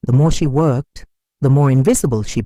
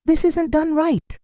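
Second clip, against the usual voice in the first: much higher, slow disapproving aunt; critical aunt; 13 semitones higher than usual. A narrator's reading. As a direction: drag out the complaint slowly, up high. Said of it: "her aunt would say".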